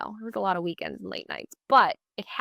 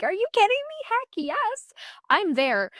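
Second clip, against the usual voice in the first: much higher, squealing with joy; thrilled; 12 semitones higher, 3 dB louder than usual. Retold or imitated. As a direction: shriek it like the dream just came true. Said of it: "I was just so excited".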